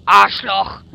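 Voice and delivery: loud high startled shouts; loud outburst. Real, not acted. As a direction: shout out in shock. Gamer reacting loudly.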